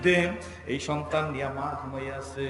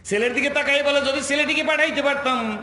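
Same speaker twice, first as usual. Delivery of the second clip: high, loud outcry; impassioned preaching shout; 11 semitones higher, 10 dB louder than usual. Retold or imitated. Preacher voicing a widow looking at her son, high shout. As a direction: cry the wish out forcefully. Preacher voices mother's wish.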